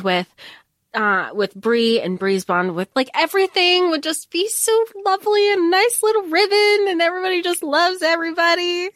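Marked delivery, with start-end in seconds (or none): 3.1-8.9 high, dreamy fangirl gushing. Real, not acted. Reader gushing to co-host about characters all loving each other, gushing. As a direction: gush in a high, wishful rush. Gushing over the hoped-for happy throuple.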